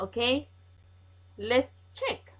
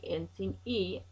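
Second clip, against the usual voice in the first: usual speaking voice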